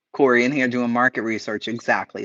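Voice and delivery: ordinary delivery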